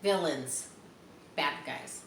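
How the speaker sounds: ordinary delivery, nothing marked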